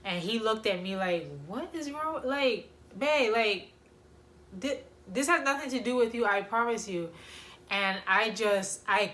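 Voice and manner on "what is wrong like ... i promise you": higher-pitched, imitating a baffled partner; retold urgent reassurance, pitched up; baffled concern. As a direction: act out a confused boyfriend insisting.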